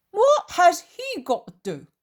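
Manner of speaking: high, loud challenging question; loud, indignant character voice; voiced Peter. Narrator voices Peter objecting.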